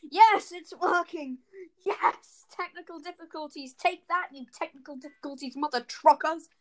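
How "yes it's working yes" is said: higher, louder triumphant cheer; excited cheer. Real, not acted. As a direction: celebrate like you won. Cheering tech fix.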